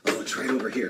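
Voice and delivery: normal talking voice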